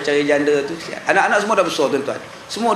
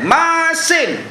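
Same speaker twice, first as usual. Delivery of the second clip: angry complaint; 9 semitones higher than usual. Acted. Husband scolds wife over soup.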